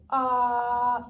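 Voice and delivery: loud sustained ahh; saying ahh. Shown as a demonstration. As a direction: hold a strong ahh. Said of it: "take a deep breath and say".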